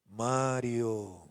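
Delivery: low, drawn-out eerie calling of a name; slow, deep mock-divine summons; mysterious night voice. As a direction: play a mysterious voice calling someone at midnight. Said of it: "a la medianoche escucha una voz".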